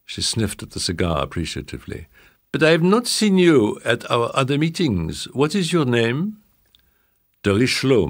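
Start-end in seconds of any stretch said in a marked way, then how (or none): 2.5-6.4 high voiced grand old madame; old French lady. A narrator's reading.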